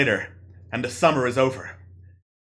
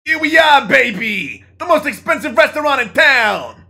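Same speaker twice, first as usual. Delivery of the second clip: higher, louder boastful greaser; cocky greaser voice; 11 semitones higher, 7 dB louder than usual. Acted. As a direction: show off to your date. Zuckerberg's line in the play.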